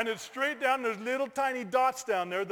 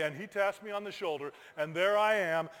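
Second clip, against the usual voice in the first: ordinary talk, nothing marked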